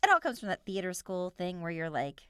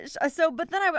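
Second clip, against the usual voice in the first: ordinary talk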